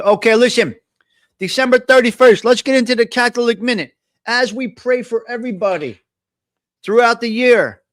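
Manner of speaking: loud, high radio host opening; host announcement. Acted.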